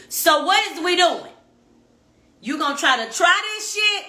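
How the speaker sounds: challenging loud ultimatum to him; 1 loud confrontational demand. Retold or imitated. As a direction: push him for an answer.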